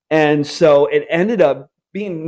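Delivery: ordinary delivery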